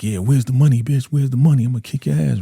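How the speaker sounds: heated retold shakedown; mock angry demand. Teresa on top of Ruth demanding money, mock angry.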